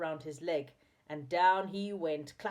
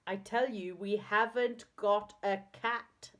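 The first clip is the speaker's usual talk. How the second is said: slow, emphatic crossness; quite cross; 4 semitones higher, 5 dB louder, slower than usual. A narrator's reading. Joe to the man who keeps mishearing, quite cross. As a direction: spell it out crossly, word by word. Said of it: "feeling quite cross".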